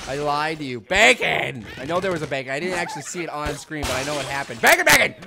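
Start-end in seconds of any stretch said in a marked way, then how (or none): 0.8-1.7 bacon yell. Acted. Yelling bacon catchphrase. 4.6-5.2 high, loud double shout; bacon yell. Acted. Gamer yelling his catchphrase at viewers, loud and high.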